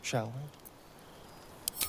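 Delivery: vampire whispering to his victim; seductive whisper. Acted. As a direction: purr it close to the ear. Vampire seducing before bite.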